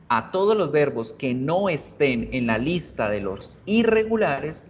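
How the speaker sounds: ordinary talk, nothing marked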